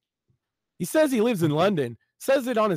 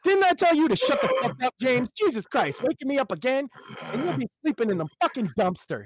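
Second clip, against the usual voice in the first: furious brother; 8 semitones higher than usual. Acted. Brother woken by James, shouting high and angry. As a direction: yell at him for waking you.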